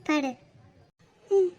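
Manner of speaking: ordinary talk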